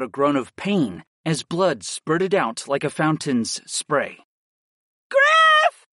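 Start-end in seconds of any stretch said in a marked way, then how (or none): 5.1-5.9 very high, loud cry of a name; alarmed yell. A narrator's reading. Aaron to Griff across the fight, yelling. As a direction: yell out sharply in alarm, pitched way up. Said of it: "I heard Aaron yell".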